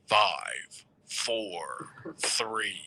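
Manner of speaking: low called-out countdown; Kelly's countdown. Retold or imitated. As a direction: count down like a studio producer. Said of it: "Kelly is the guy you hear go".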